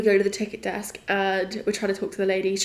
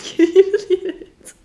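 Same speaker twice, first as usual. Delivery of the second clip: excited squeal; 11 semitones higher, 7 dB louder than usual. Retold or imitated. Telling everyone about the concert.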